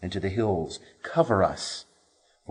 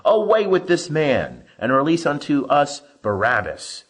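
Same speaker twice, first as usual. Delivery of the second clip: louder, higher mob shout; crowd crying out; 5 semitones higher, 4 dB louder than usual. A narrator's reading. Crowd shouting at Pilate to free Barabbas, loud. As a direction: demand it like an angry crowd. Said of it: "they cried out all together".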